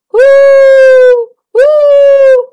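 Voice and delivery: high, loud hooting owl call. A narrator's reading. The owl calls out in the forest, loud high hoot.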